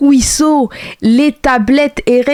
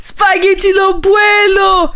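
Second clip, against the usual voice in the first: high, slow mock shout; mock catchphrase shout; 8 semitones higher, slower than usual. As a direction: belt it out like the cartoon. Repeats the clip's catchphrase.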